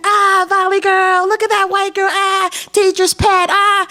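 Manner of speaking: high mock crowd heckling; mock crowd jeering. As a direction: imitate a rowdy crowd jeering. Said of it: "They were yelling and screaming".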